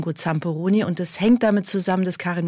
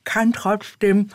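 lips rolled over teeth; 2 semitones higher than usual. Shown as a demonstration. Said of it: "Lippen einrolle über die Zähne".

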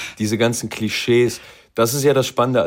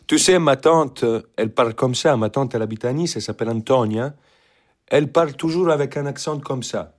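French with a lightly put-on Italian accent; français avec un accent italien; 5 semitones higher than usual. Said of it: "Französisch sprechen mit einem italienischen Akzent".

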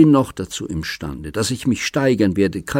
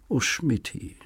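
sighing; 7 semitones higher, 6 dB quieter than usual. A narrator's reading. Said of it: "seufzte sie".

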